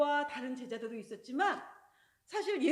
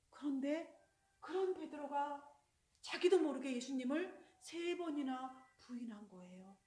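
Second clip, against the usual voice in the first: ordinary talk, nothing marked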